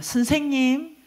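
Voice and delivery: mock drunken summons; drunk man calling out. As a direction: call "teacher" like a roused drunk. Retold slurred drunk waking up calling.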